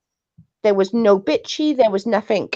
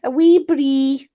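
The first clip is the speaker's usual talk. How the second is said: higher, drawn-out accent mimicry; Freddie; 5 semitones higher, slower than usual. Trying accents for co-host, slow high mimicry of a friend. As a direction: stretch it out in a friend's put-on accent. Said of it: "I sound like Freddie".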